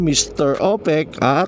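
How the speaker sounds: ordinary talk, nothing marked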